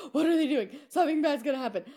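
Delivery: high, racing panic, half-laughing; anxious panicked quote. Retold or imitated. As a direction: gasp out your worry that they'll crash. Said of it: "I'm like".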